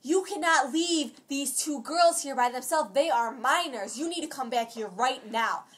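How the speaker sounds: slow, emphatic mock telling-off; going off on someone. Imitating the lady ordering Lisa back, slow mock scolding. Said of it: "going off on Lisa because of that".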